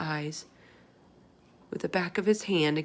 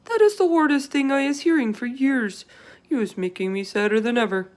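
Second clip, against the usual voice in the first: louder, higher giant voice, hurt; the giant, loud and saddened; upset giant; 6 semitones higher, 9 dB louder than usual. Acted. Giant voiced in read story.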